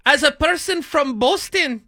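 high, slow mock Spanish accent. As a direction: exaggerate the fake Spanish accent. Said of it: "mocking her Boston origin".

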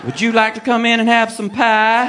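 inviting Southern belle; coaxing Southern hostess with a lilt; hammed-up Georgia accent; Georgia peach lilt. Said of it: "got that Georgia peach lilt to it".

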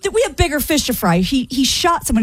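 normal talking voice

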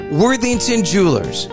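ordinary delivery

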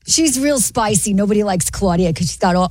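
put-on New York accent for an opinionated character; sassy New York accent. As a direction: play a spicy New Yorker. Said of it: "you do that New York one".

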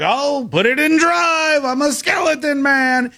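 hammed-up Halloween skeleton calling out; skeleton man voice. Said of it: "the skeleton man's like".

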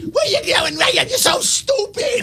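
impression of Danielle. Host mocking Danielle scolding someone, high mock yell. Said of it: "Danielle talks like this".

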